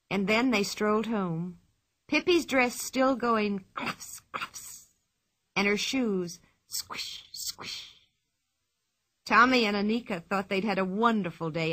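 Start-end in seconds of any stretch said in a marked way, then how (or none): 3.7-4.9 imitated wet cluffs, trailing unvoiced; squelching dress noise. A narrator's reading. Narrator imitating Pippi's wet dress, squelching. 6.7-8.0 soft unvoiced wet squish; squishing shoe noise. A narrator's reading. Narrator imitating Pippi's wet shoes, squishing.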